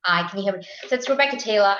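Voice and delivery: ordinary talk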